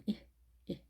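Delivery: 이 short and lax, pulled slightly back; light short vowel. Shown as a demonstration. Teacher shows learners the pulled-back vowel, short light 이. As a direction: say 이 lightly with the tongue drawn inward. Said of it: "가볍게 하시면 되겠습니다".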